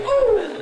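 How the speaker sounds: very high, loud yelp of pain; pained cry. Acted. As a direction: yelp as the ball strikes. Son hit by gopher ball.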